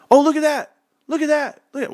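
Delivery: put-on high, eager kid calling out; excited kid voice. Kids in the back seat calling out sights, high excited voice. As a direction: point out the window like a thrilled kid.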